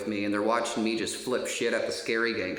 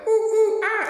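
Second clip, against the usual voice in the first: shrieking "ooh ooh ah" monkey hoots, much higher and louder; monkey noises; 10 dB louder than usual. Retold or imitated. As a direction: hoot like a monkey at full volume. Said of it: "make monkey noises".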